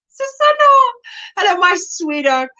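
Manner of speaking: high, sing-song hello; delighted greeting. Real, not acted. Greeting a far-away viewer, high and sing-song.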